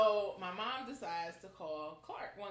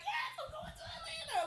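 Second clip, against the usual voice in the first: much higher, laughing shout; joyful shout. Retold or imitated. Celebrating getting into Clark, high joyful cry. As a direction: cheer it out.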